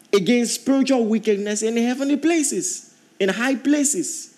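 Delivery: preacher swelling into the end of the verse; rising preaching. Acted. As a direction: build the verse toward its peak. Scripture read building.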